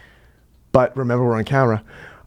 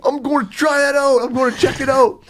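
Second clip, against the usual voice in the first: eager; 11 semitones higher than usual. Retold or imitated. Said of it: "They're like".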